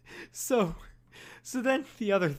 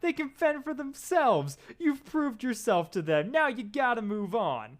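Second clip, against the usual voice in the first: pleading manager; 8 semitones higher than usual. Acted. Playing Rock's manager Mick.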